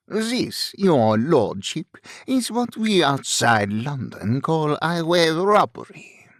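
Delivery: mock the gentleman in thick French-accented English. A narrator's reading. Robber explains highway robbery to a lordship, French accent. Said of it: "was an artificially deepened voice".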